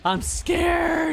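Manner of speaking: high mock-scared yelp. Gamer yelps at a close pitch, mock scared and high. Gamer reacts to tense at-bat.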